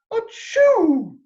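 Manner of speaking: a big mock sneeze. A narrator's reading. Queen in the feather cloud, sneezing high. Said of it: "sneezed the queen".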